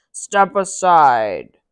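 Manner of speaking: loud, very slow giant command. Acted. Giant telling Mickey to move, slow and loud. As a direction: order it slowly and heavily.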